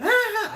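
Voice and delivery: high, loud "ah" laugh; short imitated burst of glee; mock cackle. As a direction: act out a gleeful outburst. Mock reaction he says he never had.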